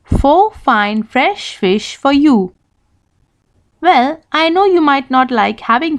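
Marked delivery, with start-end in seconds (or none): 0.0-2.5 slowly, each F crisp; slow, clear tongue twister. Shown as a demonstration. Teacher modelling a tongue twister slowly. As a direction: say it slow and clean.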